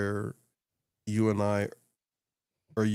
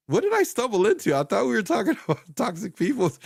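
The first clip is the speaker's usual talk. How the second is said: high, loud mock bewildered viewer; confused viewer; 12 semitones higher, 5 dB louder than usual. A viewer puzzled by the social media talk, mock bewildered and high. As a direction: play a lost newcomer, pitched way up. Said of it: "They go like".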